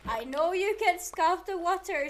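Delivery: exasperated scolding. Real, not acted. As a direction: argue loudly with a stubborn game. Scolding the uncooperative water.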